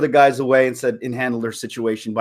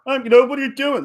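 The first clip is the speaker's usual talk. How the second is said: mock macho rescuer; 10 semitones higher, 3 dB louder than usual. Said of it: "jump in and go".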